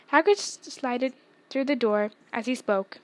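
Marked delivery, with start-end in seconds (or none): none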